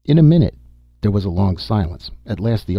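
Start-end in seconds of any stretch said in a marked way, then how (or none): 0.0-0.6 loud, high call from a distance; calling back. A narrator's reading. Tance answers from behind the gun, calling back loudly. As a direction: shout it back from out of sight.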